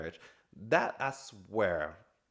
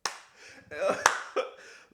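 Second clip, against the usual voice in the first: breathy laugh; 8 semitones higher, 4 dB louder than usual. Real, not acted. Laughing at the scene.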